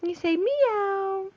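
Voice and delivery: put-on slow coaxing prompt to a toddler; slow teaching. Shown as a demonstration. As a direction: draw it out so the child copies. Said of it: "No, cat says meow".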